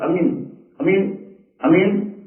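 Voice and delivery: short امین repeated, lifting at the end; clipped first vowel on امین, no lengthening. Retold or imitated. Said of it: "بس ایسا تلفظ کرتے ہیں".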